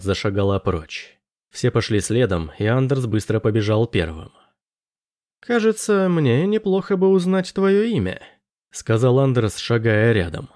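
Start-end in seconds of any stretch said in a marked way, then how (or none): 5.4-8.2 put-on lighter, higher young man voice; apologetic young man, slightly awkward; Anders voice. A narrator's reading.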